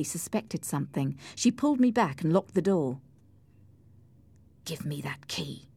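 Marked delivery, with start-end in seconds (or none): 4.6-5.7 hushed, much softer order. A narrator's reading. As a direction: demand it almost in a whisper. Tense demand for the key.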